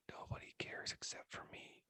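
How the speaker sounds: hushed, voiceless aside; whisper. Acted. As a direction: drop right down into a whisper. Whispered aside answering retold self-doubt.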